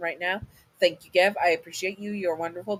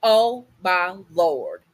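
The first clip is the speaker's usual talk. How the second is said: slow, shocked oh my Lord, a bit louder; a mother stunned, drawing it out; shocked gasp; 3 semitones higher, 6 dB louder, much slower than usual. Acted. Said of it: "She gasps".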